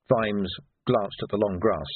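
ordinary delivery

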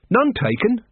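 high, lilting cheerful reply. A narrator's reading. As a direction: brush it off with good cheer. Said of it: "said Carrot cheerfully".

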